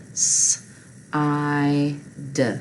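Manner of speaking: "s, i, d" as separate speech sounds; hissed s, long i, short voiced d; sounded out. Shown as a demonstration. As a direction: sound out "s, i, d" one by one, with pauses. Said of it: "So you've got your prefix in".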